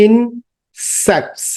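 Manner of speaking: Insects said slowly, split into parts; slow broken-up word. Shown as a demonstration. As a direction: pull insects apart for the class. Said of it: "stress, কয়ভাবে ভেঙে".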